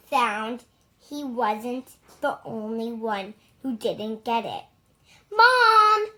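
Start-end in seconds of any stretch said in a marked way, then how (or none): 5.3-6.1 high, loud call; crying out. A narrator's reading. Clark calling his mother about the ruined cap, loud. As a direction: call out for help, aggrieved. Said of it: "cried Clark".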